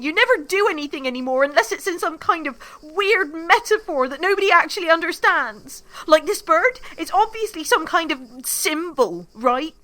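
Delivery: loud, fast, biting sarcasm; furious rant. Acted. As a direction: tear into him, sarcastic. Nina's furious tirade at Constantine.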